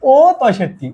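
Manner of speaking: usual speaking voice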